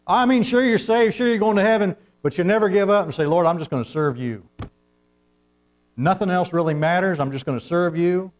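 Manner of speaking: fired-up preaching. Acted. Preacher pleading with believers to surrender, loud and high. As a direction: rise to a passionate appeal.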